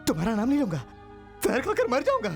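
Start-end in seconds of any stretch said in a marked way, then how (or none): 1.4-2.3 higher, breathless desperation; desperate pleading. Acted. Begging captors to release girl. (हताश गिड़गिड़ाहट)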